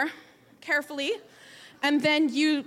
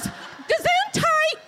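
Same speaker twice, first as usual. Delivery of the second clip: much higher, slow, drawn-out gesundheit; patient's gesundheit; 13 semitones higher, 3 dB louder, much slower than usual. Retold or imitated. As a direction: stretch it out like a cheerful old lady. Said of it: "the patient goes".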